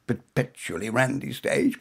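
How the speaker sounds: plain reading voice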